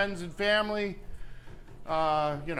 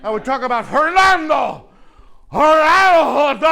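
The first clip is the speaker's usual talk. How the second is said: higher, much louder, impassioned yell; louder and higher, mimicking Fernando's intensity; intense shout; 4 semitones higher, 13 dB louder than usual. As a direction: blast it out like a fervent speaker. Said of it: "he said it with such intensity".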